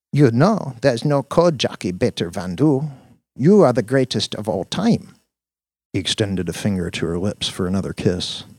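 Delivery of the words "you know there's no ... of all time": put-on higher, adoring accented girlfriend; doting accented Dina. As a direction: gush over your genius sweetheart in accent.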